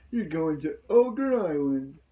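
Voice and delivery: slow, put-on character voice; lightly hammed-up Rintaro; a hint of a dramatic announcer; terrible Rintaro impression. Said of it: "I do a terrible Ranger, uh, Rintaro impression".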